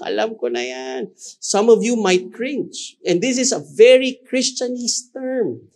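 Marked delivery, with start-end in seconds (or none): 0.0-1.1 high, mocking "I already know that"; sing-song a bored churchgoer brushing it off; bored know-it-all listener. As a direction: Tagalog quip in a teasing high voice. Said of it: "Some of you will feel".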